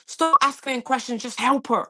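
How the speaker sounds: man shouting at paramedic to stop asking questions, angry; aggressive man quoted. Retold or imitated. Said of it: "he was just like".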